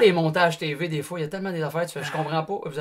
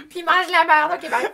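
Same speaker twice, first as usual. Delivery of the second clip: laughing; 11 semitones higher, 4 dB louder than usual. Retold or imitated. Retold prank call, laughing.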